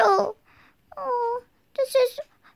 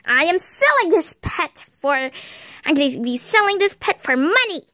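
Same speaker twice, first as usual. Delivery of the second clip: smug mom voice; 9 semitones lower than usual. Pretend. Mom voice announces sale.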